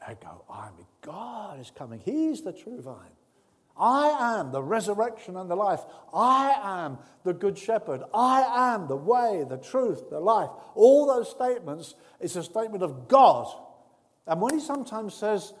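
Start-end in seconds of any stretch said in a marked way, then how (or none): 3.8-10.5 loud, ringing proclamation; full-force preaching. Acted. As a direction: preach it out to the whole church. Preaching the I am statements.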